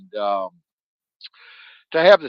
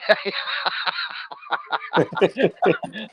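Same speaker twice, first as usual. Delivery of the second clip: real laugh; 8 semitones higher than usual. Real, not acted. Laughs at own joke.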